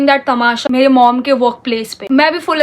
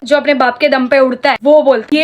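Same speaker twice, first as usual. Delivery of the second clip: ordinary talk, nothing marked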